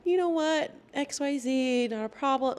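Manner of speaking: high, breezy coach impression; easygoing coach. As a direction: wave it off in a lifted voice. Imitates coach's forgiving reply.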